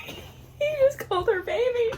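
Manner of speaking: very high, delighted squeak; swooning squeal. Real, not acted. Reader hearing the hero's pet name, swooning squeal. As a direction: squeal it, completely smitten.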